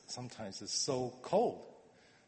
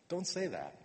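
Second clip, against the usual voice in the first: normal talking voice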